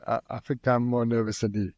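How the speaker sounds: usual speaking voice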